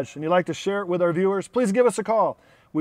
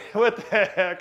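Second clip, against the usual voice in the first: ordinary talk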